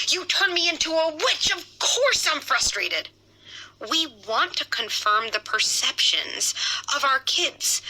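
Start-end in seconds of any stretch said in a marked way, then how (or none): 0.1-3.1 high, accusing frustrated tone; a parent snapping that the child made her a witch; blaming frustrated parent. Shown as a demonstration. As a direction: pitch up and blame the kid.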